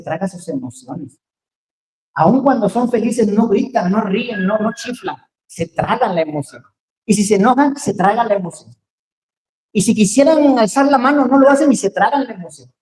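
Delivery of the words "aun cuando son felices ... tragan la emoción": preaching at full force. Acted. Preacher urging the congregation not to swallow emotion, in full fervour. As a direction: preach it, pushing the congregation to feel.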